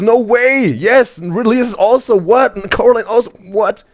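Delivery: high-pitched incredulous excitement; amazed. Real, not acted. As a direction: read it out high, in disbelief.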